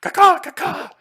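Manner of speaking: very high and loud, silly; a babbling hurt monster groaning; dazed wounded creature. Acted. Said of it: "he would say something like".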